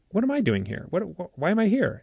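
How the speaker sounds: retold bewildered question, pitch jumping up; mock-baffled, high on the key words; bewildered protest. Abductee questioning the beings, bewildered. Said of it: "I'm looking at these beings and I say".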